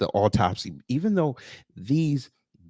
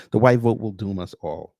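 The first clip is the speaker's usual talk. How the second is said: ordinary delivery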